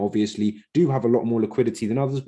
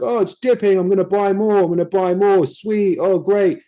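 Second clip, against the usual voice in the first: high, louder eager mock excitement; hammed-up high gushing over buying more; naive hype buyer; 8 semitones higher, 5 dB louder than usual. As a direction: play the clueless buyer thrilled by the dip. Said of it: "I'm like".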